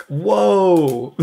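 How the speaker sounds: amazed whoa. Retold or imitated. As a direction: act out being bowled over, drawing it out. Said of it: "and I'm like".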